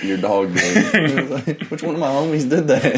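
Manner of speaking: slow laughing tease. Real, not acted. Friend cracking up while blaming the dog, laughing. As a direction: laugh through the punchline. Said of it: "joking with laughter".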